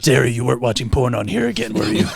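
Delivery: put-on quick, gruff old Batman; gravelly Batman voice. Old Batman accusing Terry, gruff and quick. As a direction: fire off the question like a grumpy old Batman. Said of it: "Why do you still talk like that?".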